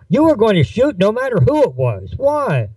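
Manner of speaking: high-pitched, insistent accusation; accusing challenge. Acted. Friend confronts the shooter about firing blindly, high insistent challenge. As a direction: press the accusation, pitch jumping up.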